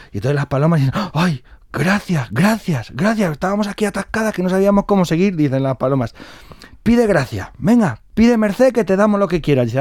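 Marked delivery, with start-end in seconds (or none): 1.1-5.4 high, gushing thanks; relieved doves. Acted. Doves thank the hanging woman for helping, high gushing relief. 6.8-9.7 high-pitched, insistent urging; eager doves. Acted. Grateful doves offer the old woman a wish, high and eager.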